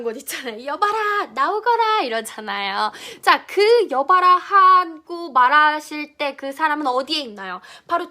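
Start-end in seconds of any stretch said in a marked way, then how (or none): 0.7-2.0 period-drama summons, starting high; lightly acted lordly summons; historical drama call. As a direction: call a servant out, sageuk style. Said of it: "우리 사극기 같은 곳 보면은".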